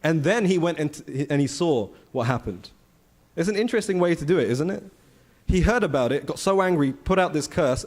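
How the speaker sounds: ordinary delivery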